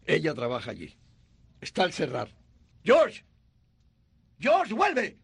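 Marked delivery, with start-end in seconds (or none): 2.8-5.2 loud, urgent call after him. Acted. Angel shouting after George as he runs off, urgent call. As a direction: shout his name to stop him.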